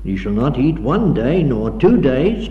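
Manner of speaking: ordinary talk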